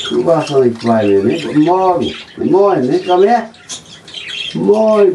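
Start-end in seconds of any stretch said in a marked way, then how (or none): 1.4-5.1 high coaxing greeting. Real, not acted.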